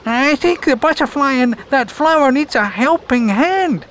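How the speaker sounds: high, concerned ladybug voice; Lindsay the ladybug. Acted. Ladybug telling the others about the butterfly, high and concerned. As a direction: point out someone in need. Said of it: "she said".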